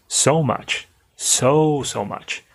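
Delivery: "so" pitched high and stretched; emphatic "so much"; stress on so. Shown as a demonstration. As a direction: hit "so" hard and high. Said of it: "you can really stress with your voice".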